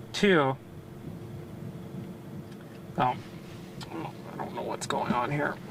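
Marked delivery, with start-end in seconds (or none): 3.0-5.6 hushed, near-whispered aside to self; low, soft and absent-minded; puzzled self-talk. Real, not acted. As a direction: puzzle it out under your breath. Puzzling over equipment to self.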